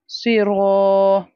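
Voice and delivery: स्वीरो with a long, full रो; heavy stretched ro. Shown as a demonstration. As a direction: draw out the रो. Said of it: "So we have to stretch the, this part". (स्वीरो में रो खींच कर)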